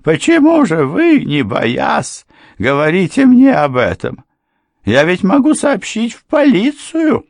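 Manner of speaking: a flustered housekeeper pushes back; housekeeper's voice. A narrator's reading. Housekeeper challenging the lawyer, high and alarmed. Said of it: "От таких слов госпожа Крент закашлялась".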